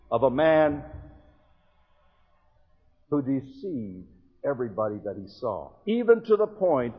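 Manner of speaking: usual speaking voice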